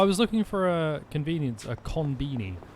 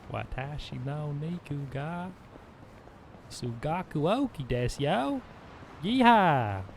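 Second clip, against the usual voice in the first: drawn-out Southern accent, building to a yeehaw; mock Southern drawl in Japanese; heavily Southern Japanese. Shown as a demonstration. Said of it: "Being heavily southern in them".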